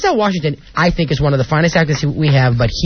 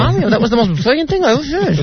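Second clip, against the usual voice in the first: higher, mannered impersonation; Barbara Walters; 5 semitones higher than usual. Retold or imitated. Retelling Barbara praising her work, in a gushing impersonation. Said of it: "Yeah, she was like".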